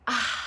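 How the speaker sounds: long airy exhale; long sigh. Real, not acted. Streamer pausing before checking in with viewers, long sigh. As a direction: let out a long, voiceless sigh. Pause before asking how others feel.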